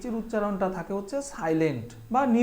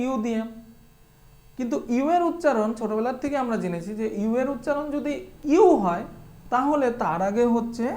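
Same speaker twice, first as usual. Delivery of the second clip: ordinary delivery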